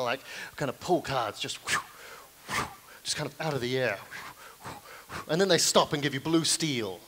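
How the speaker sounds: ordinary talk, nothing marked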